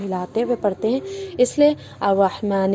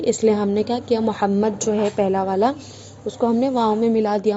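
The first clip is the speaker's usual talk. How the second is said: ordinary talk, nothing marked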